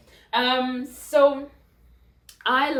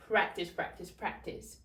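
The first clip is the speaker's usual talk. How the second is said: fading to whisper; 4 semitones lower, 8 dB quieter than usual. Real, not acted. Repeating advice, fading.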